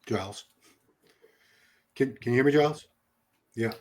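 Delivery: choppy bad connection; breaking up. Real, not acted. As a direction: voice cutting in and out over a poor line. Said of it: "you're breaking up there really bad".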